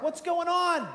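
high, loud question thrown out; startled calling out. Acted. Blind man looking around in the dark, calling out startled. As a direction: call out bewildered into the dark.

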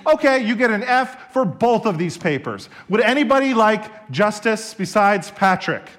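loud, high furious grilling; angry professor. Retold or imitated. Professor losing his temper at the back row, loud and high. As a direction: lose it and interrogate the class. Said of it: "Sproul loses it".

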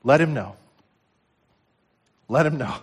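usual speaking voice

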